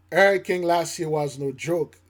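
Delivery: ordinary talk, nothing marked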